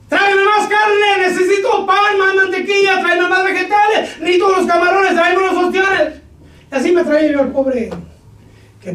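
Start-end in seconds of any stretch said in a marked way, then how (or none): 0.1-6.1 high, loud barking of orders at the grill; shouting orders. Retold or imitated. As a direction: yell for supplies in the rush hour. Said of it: "Y entonces yo". (gritando órdenes en la cocina, voz alta y aguda)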